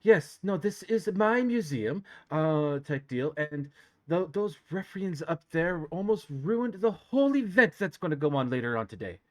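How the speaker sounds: high, loud indignant noblewoman; prim outrage about her museum; haughty elf noble. Acted.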